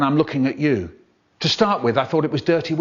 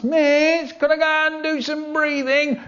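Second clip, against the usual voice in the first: put-on whiny kid; 12 semitones higher than usual. Whiny kid asking teacher to go out, whining. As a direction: plead in a high whiny child's voice. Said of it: "The whiny ones".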